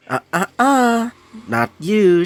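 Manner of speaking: high, drawn-out sing-song tut; teasing refusal. Acted. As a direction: wag a finger with your voice, high and slow. Character teasingly refuses an opponent.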